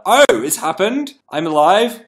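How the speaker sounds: high, loud relieved surprise; relieved mum. Retold or imitated. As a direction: play the terrified mum, relieved. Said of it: "she was like".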